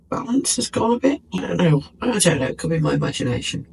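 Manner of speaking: normal talking voice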